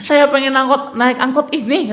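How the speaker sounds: high-pitched mock insistence; insistent refusal. Passenger refusing the driver's offer, insistent. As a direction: imitate a passenger digging in.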